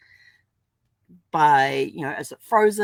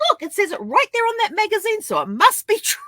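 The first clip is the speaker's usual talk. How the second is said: much higher, louder with a laugh breaking in; mock earnest, laughing; 6 dB louder than usual. She insists the tabloid is true, laughing. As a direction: insist it's true while cracking up. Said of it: "I says".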